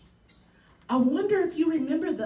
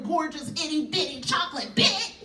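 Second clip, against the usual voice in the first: usual speaking voice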